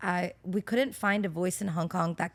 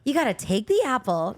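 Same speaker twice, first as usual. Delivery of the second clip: hard-sell pitch voice, higher; cheesy infomercial; 4 semitones higher, 7 dB louder than usual. Shown as a demonstration. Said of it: "it's more cheesy than that".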